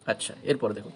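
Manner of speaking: ordinary talk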